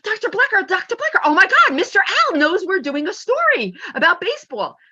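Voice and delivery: put-on high, loud, breathless kid voice; excited kids. Students rushing back to their teacher, high and thrilled. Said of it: "they're like".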